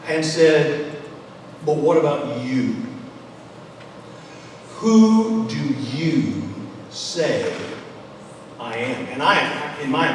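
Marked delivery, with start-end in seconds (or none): 1.6-9.0 WHO drawn out long, then long pauses before "say" and "I am"; intent, emphatic questioning. Acted. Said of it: "I can imagine him really emphasizing".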